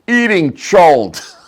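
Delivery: put-on deadpan kid answer. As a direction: say it like the obvious best mitzvah. Kid's blunt answer at graduation.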